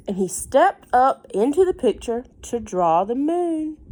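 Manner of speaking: put-on lively read-aloud voice for a child; story narration. A narrator's reading. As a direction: read it like a bedtime book.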